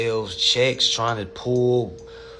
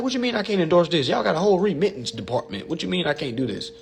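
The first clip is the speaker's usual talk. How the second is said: higher, fast outraged challenge; mock indignation at the IRS; indignant rant; 7 semitones higher, faster than usual. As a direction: demand answers from the IRS.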